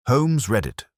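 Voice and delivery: ordinary delivery, nothing marked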